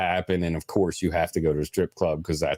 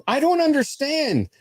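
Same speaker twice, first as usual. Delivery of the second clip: high-pitched baffled protest; put-upon, incredulous complaint; exasperated wife. Retold or imitated. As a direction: imitate a wife demanding an explanation. Wife confronts husband about towed car.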